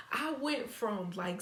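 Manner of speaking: ordinary delivery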